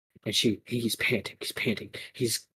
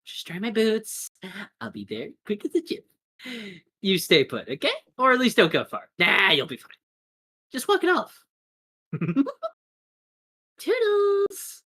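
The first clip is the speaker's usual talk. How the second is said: high-pitched, sing-song dismissal; breezy giddy villain; 10 semitones higher than usual. Acted. Attacker leaving her victim behind, breezy and giddy. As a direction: toss it off airily with a giggle.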